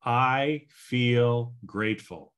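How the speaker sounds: slow, deliberate I feel grateful; slow affirmation. Shown as a demonstration. Coach demonstrating the ten-by-three affirmation slowly. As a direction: say it slowly, calmly, with conviction. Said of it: "so here we go again".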